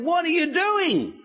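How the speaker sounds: high, drawn-out mock disbelief; incredulous challenge. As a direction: challenge him slowly, pitch soaring, as a storyteller would. Jethro challenges Moses in retold scene.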